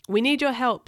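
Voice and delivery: captain calling a crewmate over; urgent call. A narrator's reading. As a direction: raise the voice, urgently. Captain radios urgently for help.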